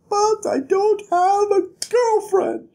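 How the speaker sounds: very high whining wail and gasp; mock a whiny lovesick Kvothe, high. Vlogger mocking Kvothe's complaint, whiny high wail. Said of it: "he's just like".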